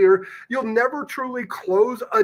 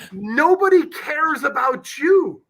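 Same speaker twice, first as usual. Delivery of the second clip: higher, louder, slow declaration; emphatic shout; 7 semitones higher, 4 dB louder, slower than usual. Real, not acted. Coach telling listeners nobody cares, loud emphatic. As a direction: drive the hard truth home. Blunt point to sales audience.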